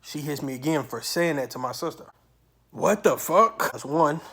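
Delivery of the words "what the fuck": loud, high, drawn-out disbelief; slow, raised-voice outrage; indignant outburst. Retold or imitated. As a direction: retell it as an exasperated cry. Retold outrage at being hit again.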